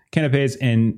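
usual speaking voice

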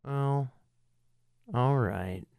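ordinary talk, nothing marked

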